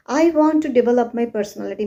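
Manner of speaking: rushed, even run-through with no word emphasised; no stress. Retold or imitated. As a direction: rattle it off fast without stressing any syllable. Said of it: "people say like this".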